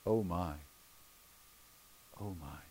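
very low, soft aside with a long pause; low, quiet, stricken reaction; hushed dismay. Acted. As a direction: let it sink in, almost under your breath. Hushed reaction after Peter's denial.

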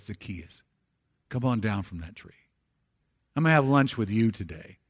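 a soft, friendly invitation to lunch; gentle Jesus. Acted. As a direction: invite him down tenderly. Jesus calls Zacchaeus down.

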